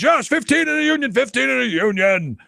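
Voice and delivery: mock street chant, starting high; imitating protesters. Host imitating people in the streets with pitchforks, chanting. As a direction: chant it like a marching crowd. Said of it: "people are in the streets with pitchforks".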